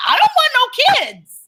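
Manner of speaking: emphatic refusal. Retold or imitated. Telling the nosy seatmate she wants no kids, in a high indignant squeal. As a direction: squeal it out like you're fed up. Retelling the flight argument.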